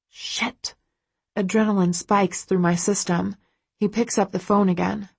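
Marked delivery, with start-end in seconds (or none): 0.1-0.8 breathy whispered curse; voiceless whispered swear. A narrator's reading. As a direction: hiss it under your breath in alarm. Narrator's inner panic.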